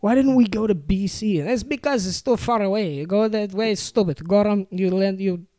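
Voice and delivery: a hint of a Polish grandpa refusing directions; imitating grandpa. Grandson imitating grandpa refusing directions, grumpy Polish accent. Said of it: "grandpa voice continued".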